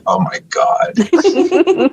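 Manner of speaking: high, slow, laughing disbelief; drawn-out laugh through the words; laughing oh my god. Retold or imitated. Husband laughing in disbelief at her soap habit, high drawn-out laugh.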